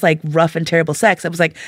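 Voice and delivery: ordinary delivery, nothing marked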